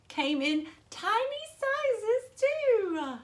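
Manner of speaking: high, slow, cooing over tiny things; sing-song baby talk voice; cutesy high voice. A narrator's reading. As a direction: coo it like talking about babies. Cooing about babies.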